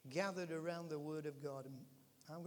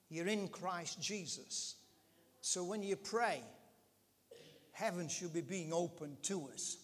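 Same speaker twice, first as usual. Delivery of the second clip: usual speaking voice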